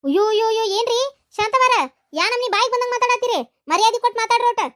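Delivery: high, loud indignant retort; shrill offended shouting; indignant outrage. Acted. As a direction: demand respect, outraged. In-law hits back indignantly.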